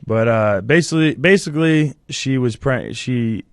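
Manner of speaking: tearful, stumbling over the words while crying. Real, not acted. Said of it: "Damn it, you made me cry".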